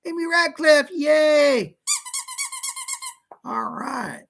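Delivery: normal talking voice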